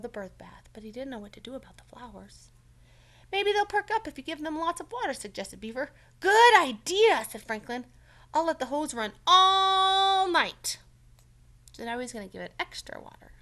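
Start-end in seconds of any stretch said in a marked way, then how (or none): 3.3-5.1 high, loud eager character voice; Beaver's voice. Acted. Teacher reading, voicing Beaver's suggestion loudly. 6.2-7.2 high, loud, slow character voice; Franklin's voice. Acted. Franklin agreeing with Beaver, high and loud. 8.3-10.8 high, loud, slow character voice; Franklin's voice. Acted. Franklin agreeing with Beaver, high and loud.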